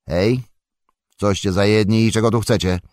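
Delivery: a stout farmer calling out to strangers; gruff farmer. A narrator's reading. Farmer Maggot challenges strangers. (zaczepny głos farmera)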